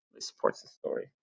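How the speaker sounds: soft unvoiced aside; whispered comment. Real, not acted. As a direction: drop to a whisper for the joke. Aside to listener.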